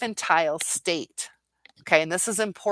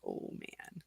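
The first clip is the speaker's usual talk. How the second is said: breathless whisper, all air; a hushed oh man on hearing bad news; whispered dismay; 18 dB quieter than usual. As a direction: mutter it to yourself under the breath. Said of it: "and I was like".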